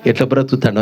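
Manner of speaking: low and sorrowful, worrying for the lost son; old mother telling her elder son of her fears for the younger, low and mournful; grieving old mother. Acted. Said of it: "అని ఏడుస్తుందట".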